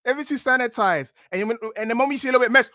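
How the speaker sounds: ordinary delivery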